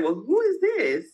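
high, put-on polite phone voice; switched-up phone voice. Caller answering in her put-on phone voice. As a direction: lift into a proper office voice. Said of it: "me switching up my voice".

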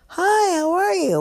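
high, lightly sung-out hello; simple greeting. Pretend. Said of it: "we can't simply go".

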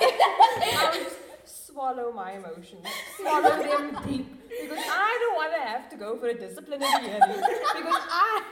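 laughing through the answer. Real, not acted. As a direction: giggle while you say it. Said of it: "joking answer while laughing".

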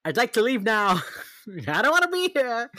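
high, loud, put-on whine; mock-whiny plea; whiny inner voice. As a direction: whine it like a desperate kid begging to leave. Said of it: "in my head, it's just like".

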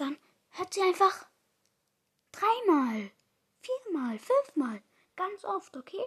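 hoch und nachdrücklich steigernd; eindringlich. Real, not acted. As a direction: werde immer drängender. Drängt Hörer begeistert. (begeistert drängend)

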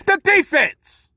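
high, loud and slow, hammered out; shouted punchline. Acted. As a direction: yell it as the obvious answer. Punchline answer to his own question.

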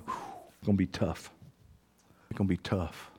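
low, soft, heavy-hearted; quiet grief. Real, not acted. Preacher picturing his son left out, quiet grief. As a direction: let it sink almost under your breath. Grieving over lost sons.

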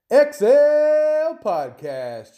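drawn out, high and loud like a hype announcer; stretched-out podcast name. Acted. Host hyping his podcast name to listeners, loud drawn-out announcer call.

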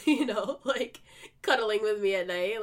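giggly and embarrassed; amused, laughing. Real, not acted. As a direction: laugh your way through the silly confession. Laughs while describing pretend boyfriend.

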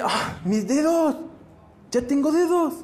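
high, slow wonder at a discovery; amazed gasp. Acted. Leper Juan finding his fingers restored, amazed.

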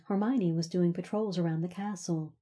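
usual speaking voice

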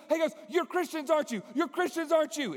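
put-on high, loud, insistent young woman; high eager girl. The Chinese girl confronting the team, high and insistent. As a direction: press them, high and pushy.

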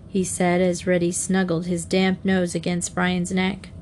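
ordinary delivery

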